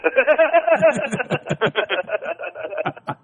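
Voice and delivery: high, loud laughing through the words. Real, not acted. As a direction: crack up mid-sentence. Laughing while describing huge fan.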